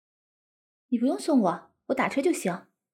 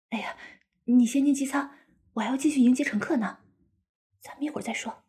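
hurried hushed whisper. Acted. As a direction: whisper so passengers won't hear. Hushed aside to husband while working. (压低声音耳语)

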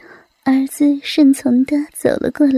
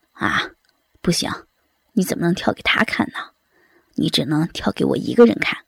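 lower, fast, hushed protest; young man's protest; 9 semitones lower, 5 dB quieter, faster than usual. A narrator's reading. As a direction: object quickly in a low voice. Son objects, voiced by narrator.

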